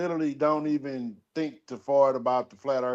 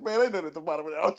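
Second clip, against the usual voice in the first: mocking a friend; 4 dB quieter, much faster than usual. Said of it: "the funniest thing he just said, said".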